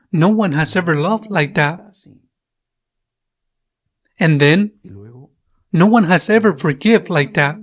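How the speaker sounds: ordinary talk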